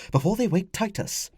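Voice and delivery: high, urgent woman's hiss. Acted. Wife fears child will wake, urgent hiss. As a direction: hiss it to protect a sleeper. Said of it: "Flavia hissed".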